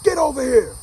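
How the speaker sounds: father's loud, high-pitched shout; manly yell. Retold or imitated. Father calls his son over from the house, manly yell. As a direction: holler it across the yard as a stern dad. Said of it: "a manly voice".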